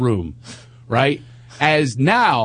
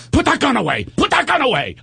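freaked-out yell; 11 semitones higher than usual. Retold or imitated. Wife yelling at the teen to drop the rifle, shrill panicked yell. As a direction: yell it like a gentle wife who's snapped. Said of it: "She freaked".

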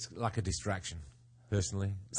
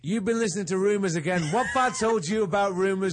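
higher and louder, hammed-up outrage; mock indignant; 9 semitones higher, 6 dB louder than usual. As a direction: act wounded and defensive. Role-play cheating boyfriend defending.

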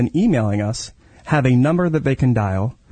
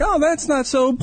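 high mock relief; 12 semitones higher than usual. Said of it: "I'm like".

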